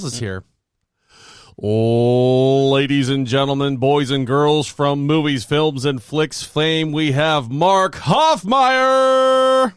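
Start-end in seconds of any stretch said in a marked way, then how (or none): 1.6-9.7 ring announcer. Pretend. Host introduces guest Mark Hoffmeyer, grand ring announcer build. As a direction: stretch it out like a ringside introduction, soaring on the name.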